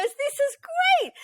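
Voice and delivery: very high, drawn-out mock delight; hammed-up squeal of approval; over-the-top excited. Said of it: "he was excited".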